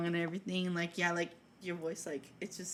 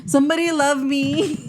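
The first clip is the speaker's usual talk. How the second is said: mock plea; 6 semitones higher, 9 dB louder than usual.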